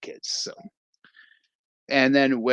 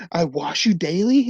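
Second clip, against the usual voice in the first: pleading with hands; 6 semitones higher, 4 dB louder than usual. Retold or imitated. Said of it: "yelling at his hands".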